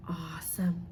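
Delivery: low soft whisper; whispered praise. Real, not acted. Teacher quietly praising the children, low whisper.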